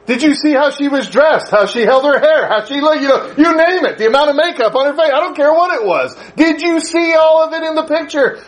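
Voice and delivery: pepper him with pointed questions; mother quizzing. Retold or imitated. Mother grilling her son about the girl, high and pressing. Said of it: "mother listing the clues".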